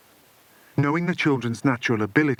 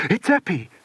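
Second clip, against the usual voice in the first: ordered; 8 semitones higher than usual. A narrator's reading. Philip ordering the others to flee, urgent shout. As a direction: order them to run. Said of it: "ordered Philip".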